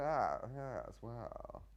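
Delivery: lightly voiced fussy gentleman; a hint of a dithering fop; mild hammy aside. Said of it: "I was playing it all, sort of".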